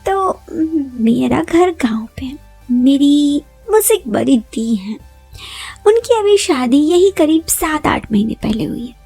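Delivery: ordinary delivery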